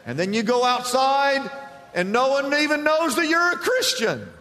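high, loud, driving sermon voice; full-force pulpit retelling; fiery preaching. Acted. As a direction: preach it hard, climbing with every line. Closing exhortation at full force.

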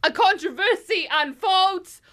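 slam poetry. Acted. Host apologising to Crocs in verse, slam poetry. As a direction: announce it like a dramatic slam poet. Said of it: "so it's in that style".

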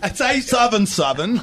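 number read in a Cockney accent. Shown as a demonstration. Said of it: "give it to him in a Cockney accent".

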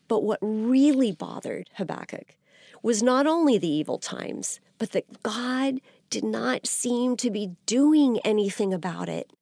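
ordinary delivery

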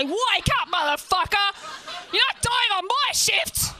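high, forceful yell; frantic shouting. Acted. Youth worker slapping overdosed Shane, frantic shouting. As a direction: shout them back to consciousness.

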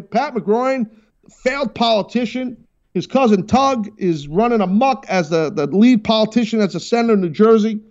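high-pitched, urgent studio summons; calling in guest. Real, not acted. Calling guest in as show intro.

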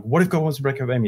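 quick run-together repeat; hurried question, repeated under pressure; rapid repeating. Retold or imitated. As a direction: rattle it off without a break. Forced to repeat it in panic.

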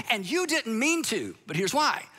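ordinary delivery

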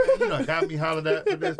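high, laughing mock complaint; telling his son he got him yelled at, laughing; laughing protest. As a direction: laugh through the words at the injustice. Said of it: "I'm like".

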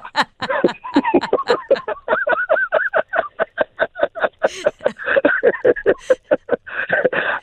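long high laugh; long real laugh. Real, not acted. Laughing at his own joke.

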